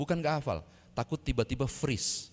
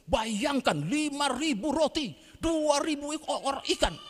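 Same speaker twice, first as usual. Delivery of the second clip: fervent preacher; 11 semitones higher than usual. Retold or imitated. Preacher excitedly garbles the miracle.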